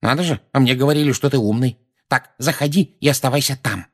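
put-on high, scolding old woman; testy high-pitched reproach; irritated. A narrator's reading. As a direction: lecture the boy with exasperation. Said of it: "раздражённо ответила миссис Фиг".

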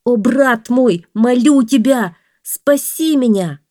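high, loud, slow begging; man in the well imploring his brother; desperate man. A narrator's reading. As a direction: plead with everything left.

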